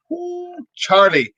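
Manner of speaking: high, slow drawn-out ooh Charlie; excited ooh. Real, not acted. Excited exclamation.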